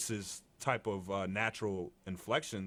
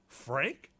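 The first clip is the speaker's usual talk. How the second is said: surprised interviewer; 8 semitones higher, 4 dB louder than usual. Retold or imitated. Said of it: "they're like".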